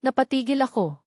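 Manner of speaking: plain reading voice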